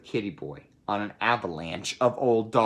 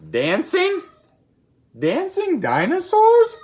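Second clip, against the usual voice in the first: leaping far higher and louder, incredulous; mock disbelief; 7 dB louder, slower than usual. Reader asking the kids about dinosaurs, hammed-up surprise. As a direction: squeal it in playful astonishment.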